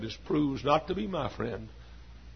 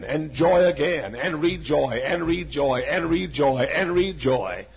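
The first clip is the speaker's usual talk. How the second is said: loud, rising sermon cry; full-force preaching; 3 semitones higher, 5 dB louder than usual. Acted. Preacher declaring his love for God, preaching full force. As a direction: preach it out to the back pew.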